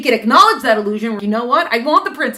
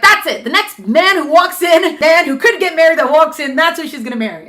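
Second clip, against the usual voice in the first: a louder, higher fed-up king shouting his decree; the king losing patience and bellowing; 6 semitones higher, 8 dB louder than usual. Acted. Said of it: "he's so fed up".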